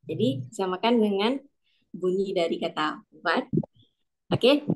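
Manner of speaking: ordinary talk, nothing marked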